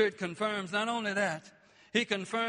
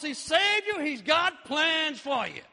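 preaching at full force; 4 semitones higher, 6 dB louder than usual. Acted. Shouted preaching.

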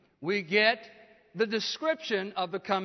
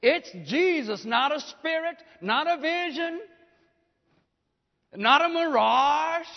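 emphatic preaching; 5 semitones higher, 4 dB louder than usual. Acted. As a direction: hammer each denial, rising. Preacher's rising emphatic list.

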